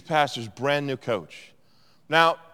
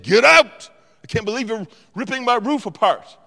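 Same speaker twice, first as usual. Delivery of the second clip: much higher, much louder get out; mock shout; 8 semitones higher, 8 dB louder than usual. Jesus hypothetically yelling at the roof diggers, mock outburst. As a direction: pretend to lose it at the roof wreckers. Jesus could have yelled.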